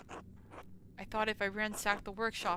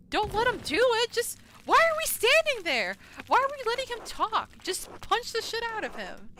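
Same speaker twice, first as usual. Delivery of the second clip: high, loud exasperated rant; frustrated yelling; 12 semitones higher, 10 dB louder than usual. Real, not acted. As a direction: yell at the characters to act.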